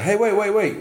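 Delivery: high, hurried mock plea; urgent calling after. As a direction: call after someone walking away.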